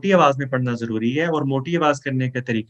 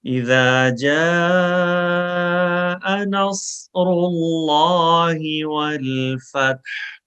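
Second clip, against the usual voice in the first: slow, melodic tajweed chant; Quran recitation; 4 semitones higher, 4 dB louder, much slower than usual. Shown as a demonstration. Teacher reciting for students to repeat after, slow melodic chant. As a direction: recite it slowly for students to echo.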